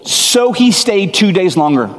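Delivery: incredulous stressed retelling. Shown as a demonstration. Preacher stunned at the verse, incredulous. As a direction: stress how strange the delay is. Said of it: "He loved them, heard he was ill".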